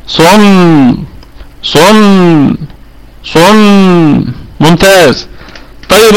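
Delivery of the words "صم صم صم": صم with the lips rounded on the ص, then spread on the ميم with a held nasal hum; lips closed then opened. Shown as a demonstration. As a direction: demonstrate صم slowly, the lips pressed shut and then opening into a light smile. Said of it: "نقول يلا". (نطق صم بضم الشفتين في الصاد ثم بسطهما في الميم مع غنة)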